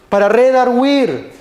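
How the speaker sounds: redarguir drawn out slowly, each syllable weighted; slow emphatic redarguir. Shown as a demonstration. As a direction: lean hard and slow on redarguir.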